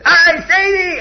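a high, loud yelp of mock pain; child's pretend scream. Child fakes a jammed finger, loud high yelp. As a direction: cry out as if a finger got jammed. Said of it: "And he screams out".

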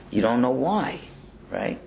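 normal talking voice

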